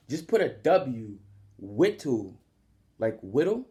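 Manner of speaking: whittle said like little with a W; whittle, rhymes with little; name pronunciation. Shown as a demonstration. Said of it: "this is how I pronounce the name".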